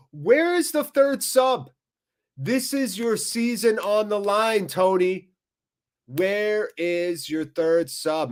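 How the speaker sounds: exasperated. Real, not acted. Pleading for a substitution.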